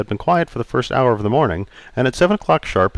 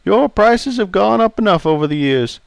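put-on raised, jovial old customer; 11 semitones higher than usual. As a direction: play Charlie, teasing.

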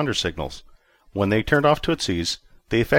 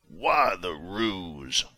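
softer, very slow wary question; suspicious murmur; 5 dB quieter, much slower than usual. A narrator's reading. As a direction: let the suspicion creep in. Commander suspects a trick.

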